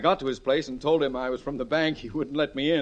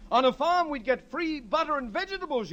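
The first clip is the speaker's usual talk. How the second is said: higher, mocking their pitch; husband mocking the family's farm dream, higher; mimicking family; 11 semitones higher than usual. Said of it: "you said".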